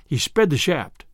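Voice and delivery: ordinary delivery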